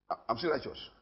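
ordinary talk

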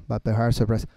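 low, droning monotone; lifeless wrong way. Shown as a demonstration. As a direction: read it out with no feeling at all. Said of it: "No, así no". (monótono y grave, sin emoción)

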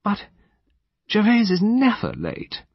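high, bewildered lady's voice; halting puzzlement, pitched high; puzzled. A narrator's reading. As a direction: pause after the first word, bewildered. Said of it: "said in a low, puzzled voice".

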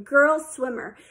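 usual speaking voice

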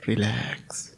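very soft, whispered, fading cue; hushed relax. Real, not acted. Meditation guide repeating the cue to relax, hushed whisper. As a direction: let the word melt into a whisper.

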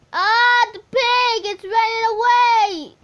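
high, loud sing-song wail; panicked squeal. Real, not acted. Kid gamer wailing as the pig runs off, high and loud. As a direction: shriek as the pig escapes.

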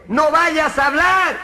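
agudo, lento y fuerte; voz de personaje. Acted. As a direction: gritarle al de abajo desde arriba. El de arriba advierte. (el de arriba grita hacia abajo, lento y agudo)

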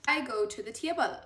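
ordinary delivery

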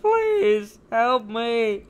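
high, slow cry for help; desperate plea. Acted. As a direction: beg for rescue, drawn out. Voicing NPC crying for help.

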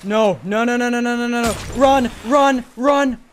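frantic panicked yelling; screaming while fleeing for your life; being chased. Real, not acted.

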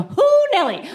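sharp high whooping exclaim; hoo nelly exclamation. Acted. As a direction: yelp it out like you can't believe her. Exclaiming about the strict lady.

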